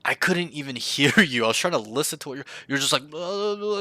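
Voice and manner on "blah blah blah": high, blurred mumbled babble; mock mumbling. Coach mimicking the student's unclear calls, mock mumbling. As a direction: imitate someone mumbling nonsense. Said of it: "you're mumbling".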